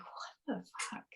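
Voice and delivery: breathy stunned whisper; whispered disbelief. Retold or imitated. Reading about cancer healed by anger, she whispers in shock. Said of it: "And I was like".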